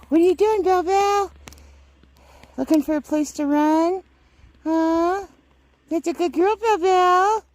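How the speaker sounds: high sing-song pet talk. Real, not acted. Talking to her dog Bell Bell in high pet talk. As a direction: coo at the dog.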